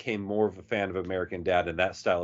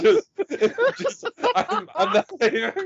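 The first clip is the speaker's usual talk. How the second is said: laughing; 5 dB louder than usual. Real, not acted. Laughing through bit.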